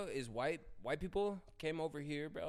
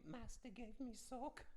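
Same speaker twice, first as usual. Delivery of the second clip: high, slow, hushed elf voice; a small timid house-elf; Dobby voice; 10 semitones higher, 11 dB quieter than usual. Pretend. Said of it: "I was like".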